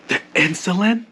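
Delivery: loud, high, jumping-in question; eager interruption. Retold or imitated. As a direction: cut in loudly as an over-eager helper. Coworker cuts in with wrong remedy.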